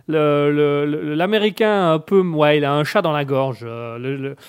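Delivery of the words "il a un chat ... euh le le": usual speaking voice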